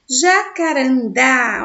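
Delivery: slow, loud "Jacarandá", weight on final "dá"; last syllable stressed. Shown as a demonstration. Teacher shows an oxytone, "Jacarandá" slowly with "dá" strongest. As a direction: draw out "Jacarandá", landing hard on "dá". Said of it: "a última sílaba mais forte".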